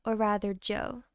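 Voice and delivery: ordinary delivery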